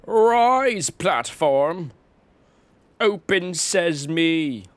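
hammed-up spell casting; mock incantation. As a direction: playfully command a platform to rise. Commanding the game's platform.

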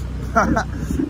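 short burst of laughter; real, spontaneous chuckle; amused laugh. Real, not acted. Vlogger tickled by friends' sidecar, amused laugh.

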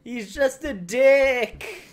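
slow, loud, high insult; drawn-out outraged shout; indignant shout. Real, not acted. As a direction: yell it at the villain. Yelling at game character.

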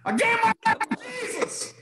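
joyful shout of a newly saved man; shouting at the top of his lungs. Retold or imitated. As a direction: bellow it out to the whole cellblock. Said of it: "I just shouted".

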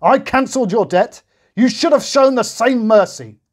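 very high, loud rebuke; roaring king. A narrator's reading. The king rebuking the unmerciful servant. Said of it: "he roared".

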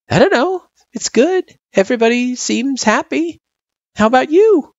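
put-on high-pitched child character; friendly kid. A narrator's reading. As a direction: answer as a kid, pitched well up.

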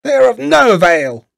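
very high, loud dismissal; scornful witch's cry. A narrator's reading. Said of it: "cried the old woman".

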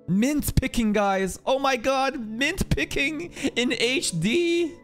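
high-pitched, drawn-out fake hype; mock excitement. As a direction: hype up something dull as if thrilled. Mock hype over mint picking.

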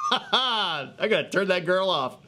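laughing it off, slowed down; amused real laugh while talking. Real, not acted. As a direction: chuckle through the line at the interruption. Laughing at Siri interrupting.

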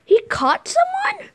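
high startled question; surprised. Real, not acted. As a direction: ask it in shock. Shocked by catch.

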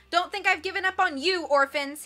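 loud taunting call. A narrator's reading. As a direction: call it across the lawn. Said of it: "he called to them".

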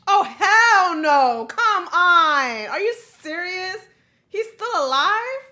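high, loud incredulous outburst; outraged disbelief. Real, not acted. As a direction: exclaim at an unbelievable twist. Outraged reaction to the show.

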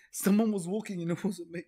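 alarmed. Real, not acted. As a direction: blurt it out in alarm. Hears footsteps in the game.

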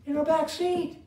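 excited exclamation. Acted. Preacher's dramatic exclamation.

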